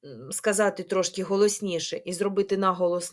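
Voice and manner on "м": ordinary talk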